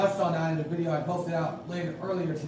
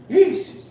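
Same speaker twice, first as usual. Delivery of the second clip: a loud, high yawning "eesh" that breaks out mid-call; 9 semitones higher, 8 dB louder than usual. Real, not acted. Commentator yawning late at night on his broadcast. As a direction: let a real late-night yawn escape. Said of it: "Sorry, I'm yawning".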